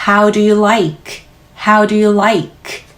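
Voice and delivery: very slow, each word drawn out; slow drill. Shown as a demonstration. Teacher models the phrase slowly for learners. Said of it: "L 소리는 윗니 뒤에 혀 밀면서".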